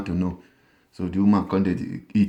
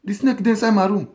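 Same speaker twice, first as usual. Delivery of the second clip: fake panicked cry; 12 semitones higher than usual. Wife faking fear to call her husband back, high panicked cry. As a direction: scream for daddy like the snake is real. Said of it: "from parlour".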